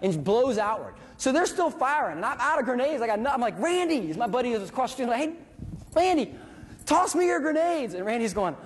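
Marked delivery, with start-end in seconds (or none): 3.6-7.9 high call across the street. Retold or imitated. Soldier calling across the street to his buddy for grenades.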